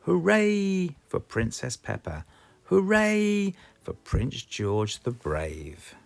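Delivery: plain reading voice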